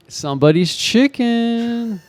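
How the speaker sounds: slow, high imitation of his odd accent; mock Tommy voice. Retelling the roommate's shower line, slow high mock voice. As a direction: drag it out high and slow like the friend.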